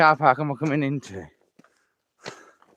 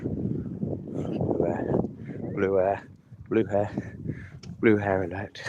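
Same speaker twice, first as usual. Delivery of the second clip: hushed whisper; 7 semitones lower, 7 dB quieter, slower than usual. Real, not acted. Spotting someone, joking alert.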